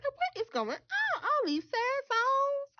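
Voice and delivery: high, slow mock wail; hammed-up high wail about sad songs; mock wailing self. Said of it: "I'm like".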